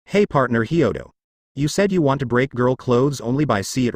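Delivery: plain reading voice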